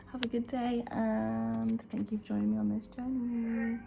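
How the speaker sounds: ordinary delivery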